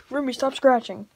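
loud sharp scolding; stern call. Real, not acted. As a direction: tell the dog off loudly. Scolding the dog.

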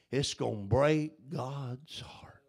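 hushed breath of a whisper; soft, intimate whispered warning. Acted. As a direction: drop to a secret whisper. Whispered aside in sermon.

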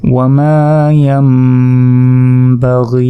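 long "মা", hummed m in "ইয়াম", long "গি"; qalb recitation. Shown as a demonstration. Teacher reciting the joined words, qalb recitation. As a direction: hold the m of "ইয়াম" before "বা" and stretch "গি". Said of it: "ইয়া গিয়ে মিমকে ধরবে".

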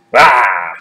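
loud short growling roar; troll roar. Acted. Troll leaps onto the bridge.

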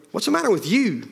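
high, swooping startled question; taken aback, voice swinging high. A narrator's reading. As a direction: ask with pitch leaping up in alarm. Husband questions his frightened wife.

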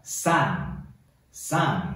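slow, drawn-out read-along "son"; 领读示范. Shown as a demonstration. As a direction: model the word slowly for learners to echo. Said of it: "一起读，来". (拉长慢读，带读单词)

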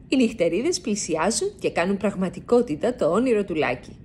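ordinary delivery, nothing marked

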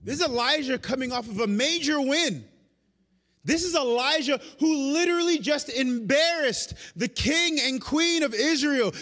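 usual speaking voice

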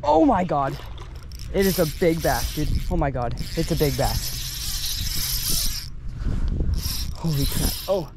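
amazed, breathless awe; fighting a fish. Real, not acted. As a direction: gasp at the size of it. Reeling in big bass, amazed.

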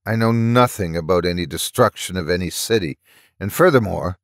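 ordinary delivery, nothing marked

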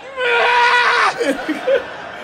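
high, loud yell; mock terrified scream. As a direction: scream awake from a nightmare. Bolting awake screaming.

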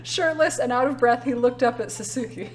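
laughing while reading. Real, not acted. Streamer reading a ridiculous Naruto fanfic aloud, laughing. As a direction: read it aloud through giggles.

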